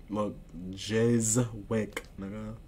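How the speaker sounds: "Jizwick" drawn out very slowly in two parts; slow, syllable-by-syllable "Jizwick"; teaching the word. Shown as a demonstration. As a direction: stretch "Jizwick" into a long Jizz, then wick. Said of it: "I'ma teach you how to spell it".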